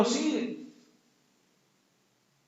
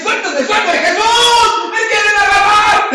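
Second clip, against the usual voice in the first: high, loud cry for help; struggling shout; 12 semitones higher, 12 dB louder, slower than usual. Acted. Bartimeo fights those seizing him, shouting to Jesús. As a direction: yell for rescue while being grabbed.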